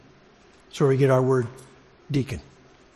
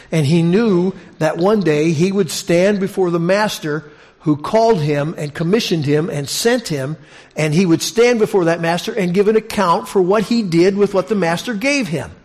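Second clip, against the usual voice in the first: rising preaching; 4 semitones higher, 5 dB louder than usual. Acted. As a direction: drive it home like a pulpit climax. Preacher builds to climax.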